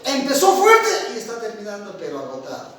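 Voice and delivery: fading from strong to exhausted. Shown as a demonstration. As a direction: sound tired as the sentence ends. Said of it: "el profesor empezó la clase de comunicación". (de fuerte a agotado)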